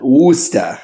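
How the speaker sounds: Boston-style Worcester, "WOO-stuh"; Boston accent. Shown as a demonstration. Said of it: "say it with a Boston accent".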